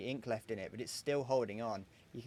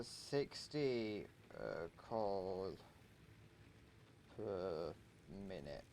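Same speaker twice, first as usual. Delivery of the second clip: slow dictation; 4 semitones lower, 10 dB quieter, much slower than usual. Real, not acted. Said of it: "Just so I remember".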